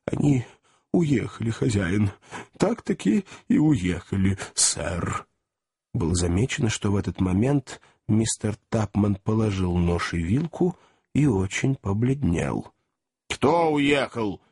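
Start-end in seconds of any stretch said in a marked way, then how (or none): 0.1-5.3 hesitant servant, lightly voiced; timid. A narrator's reading. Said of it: "перевёл дух и робко проговорил". 13.3-14.4 mildly angry, slow and higher; angry question. A narrator's reading. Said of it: "сердито спросил".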